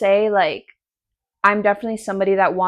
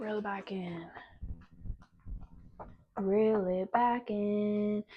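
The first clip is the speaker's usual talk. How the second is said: slow soft; 12 dB quieter, much slower than usual. Real, not acted. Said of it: "self-instruction repeated".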